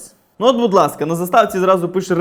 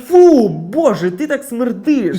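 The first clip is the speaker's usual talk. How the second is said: loud drawn-out "phew", slow revulsion; hammed-up disgusted exclamation; acted disgust; 2 semitones higher, 5 dB louder, much slower than usual. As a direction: recoil from a stink, stretching every word. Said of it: "що тобі скажуть".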